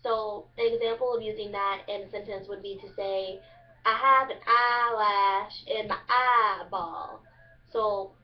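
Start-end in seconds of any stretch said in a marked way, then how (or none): 3.8-7.2 loud, slow Eastern Kentucky drawl, I stretched to "ah"; exaggerated Eastern Kentucky accent. Shown as a demonstration. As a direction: overdo the Kentucky "ah" in eyelash and eyeball. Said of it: "you just exaggerate the I sounding".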